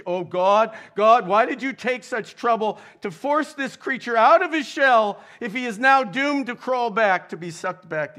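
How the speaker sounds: loud, high, pleading outcry; grieving widower crying out to God; anguished cry to God. A narrator's reading. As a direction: hurl the question at heaven. Reading C S Lewis's anguished prayer.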